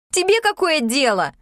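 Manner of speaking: high-pitched angry snap; sudden anger. A narrator's reading. Yulka rounds on Sashka's meddling, angry. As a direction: flare up at a nosy question. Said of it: "неожиданно разозлилась Юлька".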